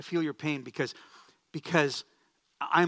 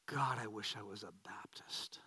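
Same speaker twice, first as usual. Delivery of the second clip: hushed, low whisper of a secret wish; whispered private thought; 7 semitones lower, 11 dB quieter than usual. Retold or imitated. Young pastor thinking alone in his closet, whispered secret wish. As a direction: whisper it like a guilty confession to yourself. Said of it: "this thought goes through my head".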